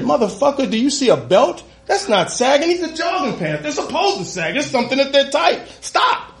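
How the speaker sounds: high, exasperated rant ending in a squeaky stop; mock outraged rant at the cop; retold outburst. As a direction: scold the cop, pitch rising to a yelp. Arguing with cop about pants.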